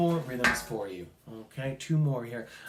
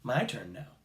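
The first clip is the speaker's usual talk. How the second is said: ordinary delivery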